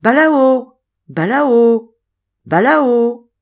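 calling the name out high and loud. A narrator's reading. Madeleine calling Balao through the forest, loud high call. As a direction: call him home across the woods. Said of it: "appelant de sa voix la plus douce".

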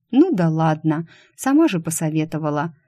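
ordinary delivery, nothing marked

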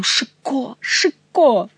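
exclaim in dismay. A narrator's reading. King dismayed by Chicot's gloomy news, exclaiming. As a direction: call out in despair, king's voice. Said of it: "воскликнул король".

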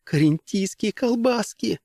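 high, slow delighted gasp. Acted. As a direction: marvel at the treat. Rosalyn continues delighted gasps.

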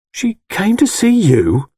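slow, high-pitched, incredulous question; dumbfounded. A narrator's reading. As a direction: repeat it slowly in stunned disbelief. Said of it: "I asked, dumbfounded".

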